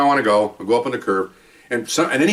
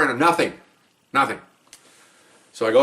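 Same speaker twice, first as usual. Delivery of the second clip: normal talking voice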